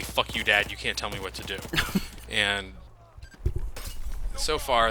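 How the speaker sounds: ordinary talk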